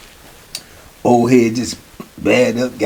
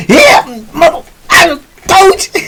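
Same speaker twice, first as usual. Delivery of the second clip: much higher, strained, crowing; triumphant old head. Retold or imitated. Old head crowing over the young boy as he attacks. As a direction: gloat as he lands the blow. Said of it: "old head was on him like this".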